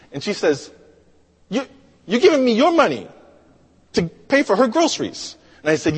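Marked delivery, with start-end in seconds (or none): 1.5-5.4 high, halting disbelief; astonished question, pitched well up; incredulous cashier. Retold or imitated. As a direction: stumble on the first word, then rush up high.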